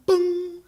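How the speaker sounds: high-pitched vocal "boom"; email alert noise. Retold or imitated. Comic voicing an email arriving out of nowhere, sudden high boom. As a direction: pop it out suddenly, high and ringing.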